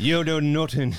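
quick mock Conor McGregor-style Irish; Irish accent. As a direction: toss out a jokey Irish brogue. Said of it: "We're not Conor McGregor fans".

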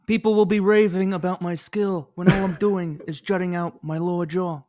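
put-on jaw-forward movie-star voice; Marlon Brando. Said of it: "Anyone can do this impression".

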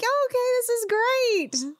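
high, swooning and smitten; dreamy charmed voice. Retold or imitated. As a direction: gush it like someone totally charmed. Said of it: "I'm like".

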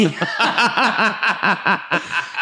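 long burst of laughing; helpless run of laughs; real laughter. Real, not acted. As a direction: crack up at the jibe. Laughing at jibe about impression.

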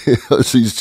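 ordinary delivery

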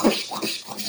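breathy fiery whooshes; imitated whoosh. Recounting the flaming slap to co-host, breathy whoosh. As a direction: whoosh like flames catching on a hand. Imitates the hand catching fire.

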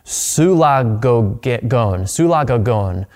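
drawn-out, deliberate pronunciation; slow reading. Shown as a demonstration. Preacher sounding out a Greek word for listeners, slowly. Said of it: "I'm gonna read it slowly".

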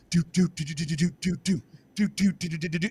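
low, soft drum-beat vocalizing; imitated marching drums. Mimicking British soldiers marching in rows, drum beats. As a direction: hum the redcoat drum line.

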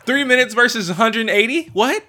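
high, loud disbelieving "What?"; incredulous. Real, not acted. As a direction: shoot the question up in disbelief. Incredulous at friend's math.

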